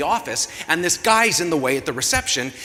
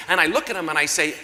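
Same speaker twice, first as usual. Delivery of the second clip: usual speaking voice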